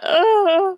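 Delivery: high, loud, drawn-out "ugh" of sorrow; mock sad wail. Co-host hearing about the Coreys, mock-heartbroken high groan. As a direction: let out a hammed-up whimpering groan. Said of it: "make me feel so sad".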